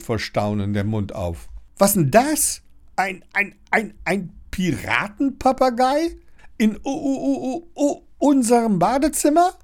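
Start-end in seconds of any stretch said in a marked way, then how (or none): 1.8-9.5 high, astonished stutter; high-pitched boy tripping over his words; stammering. A narrator's reading. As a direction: stammer in disbelief, stuck on the first sounds. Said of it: "stammelt er".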